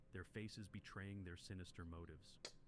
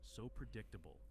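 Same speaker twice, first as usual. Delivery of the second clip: ordinary delivery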